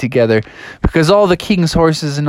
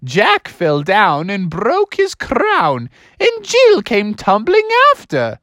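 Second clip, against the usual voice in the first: high falsetto teacher reciting; Mother Goose; 13 semitones higher, slower than usual. Acted. Substitute teacher recites to the class, high falsetto goose. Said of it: "And she said".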